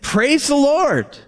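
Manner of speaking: high, drawn-out praise; exclaimed praise. Retold or imitated. Ezra blessing the Lord before the people, high exclaimed praise. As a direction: lift it high and stretch it out slowly.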